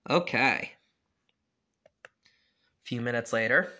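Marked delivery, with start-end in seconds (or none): none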